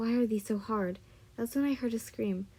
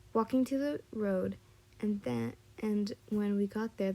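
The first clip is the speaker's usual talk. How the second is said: plain reading voice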